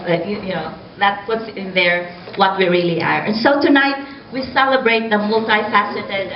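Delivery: normal talking voice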